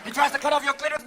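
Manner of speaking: ordinary talk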